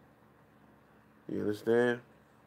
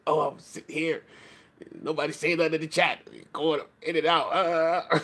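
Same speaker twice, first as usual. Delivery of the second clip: higher-pitched mock whine of a frustrated streamer; lightly put-on voice mocking someone grumbling at his chat; mocking imitation; 5 semitones higher than usual. Mocks the frustrated streamer's complaint.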